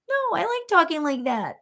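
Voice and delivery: high, goofy put-on reply; teasing silly mom voice; funny voice. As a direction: answer playfully in a silly high voice. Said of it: "I used to make these funny voices".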